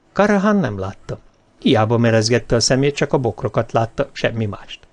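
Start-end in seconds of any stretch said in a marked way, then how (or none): none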